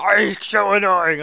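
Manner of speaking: slow, drawn-out mock complainer; mocking imitation. Gamer imagines another player griping about him, mocking imitation. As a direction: drag it out like someone moaning about you. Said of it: "He's probably like".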